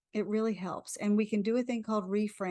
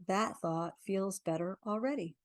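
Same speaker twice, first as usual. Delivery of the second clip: guided affirmation. Acted. Leads a tapping affirmation.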